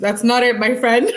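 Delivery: laughing. Real, not acted. Laughing reply to viewer.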